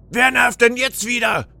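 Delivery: high-pitched, irritated yell; shouting. A narrator's reading. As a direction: yell at whoever is at the door to go away. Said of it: "schrie Pixelisa lauthals".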